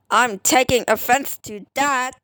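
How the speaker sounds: ordinary talk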